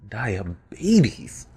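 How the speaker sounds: "Diabetes" drawn out with a pitch jump mid-word; playful emphasis. Retold or imitated. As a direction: stretch "Diabetes" and punch the BE. Playfully repeats the catchphrase.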